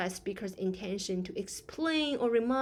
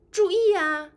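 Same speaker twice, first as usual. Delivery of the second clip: urgent warning; 10 semitones higher, 8 dB louder than usual. Shown as a demonstration. Teacher demos urgent warning.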